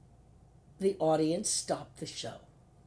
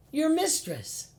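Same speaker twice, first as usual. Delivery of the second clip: high, louder comic punchline; 11 semitones higher, 4 dB louder, slower than usual. Retold or imitated. Actress reminding co-star she played his mistress, high and playful. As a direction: land the joke high and bright. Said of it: "and I said".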